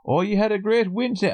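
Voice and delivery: high, bright congratulating tone, mock chummy; cheerful colleague. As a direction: imitate a colleague delighted about the win. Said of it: "people coming up to me in work today going".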